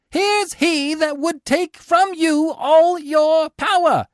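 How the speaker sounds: high, slow, loud shout. Acted. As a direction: rouse the crowd against him. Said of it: "Sicinius shouts to the citizens".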